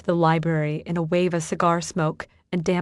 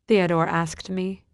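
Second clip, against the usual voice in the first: plain reading voice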